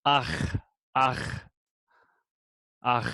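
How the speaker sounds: "ach" with a long throaty friction at the end; short open "a", then scrape the "ch" in the throat; word-final guttural ch. Shown as a demonstration. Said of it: "It would be pronounced".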